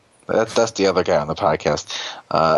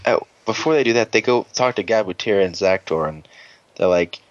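normal talking voice

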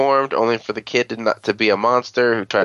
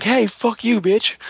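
high sassy comeback; mock outraged retort; 11 semitones higher than usual. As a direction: fire it back high and offended. Villain snaps back at insult.

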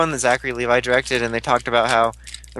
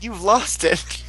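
higher, slow, unimpressed; a light mock of a flat, unimpressed girlfriend; deadpan girlfriend; 8 semitones higher, much slower than usual. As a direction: drag it out like she's done with him. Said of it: "And she's like".

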